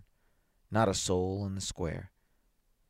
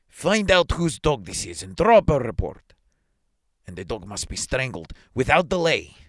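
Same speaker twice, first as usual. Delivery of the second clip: loud, raised official command; barked order; 4 semitones higher, 7 dB louder than usual. A narrator's reading. Superintendent ordering the policeman to act, loud command. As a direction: issue orders at full authority. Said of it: "cries the superintendent, addressing the policeman".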